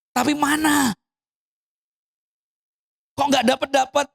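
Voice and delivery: high-pitched mock grievance; acted complaint. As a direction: demand an answer from God in protest. Acted complaint to God about unanswered prayer. (menirukan keluhan menuntut jawaban)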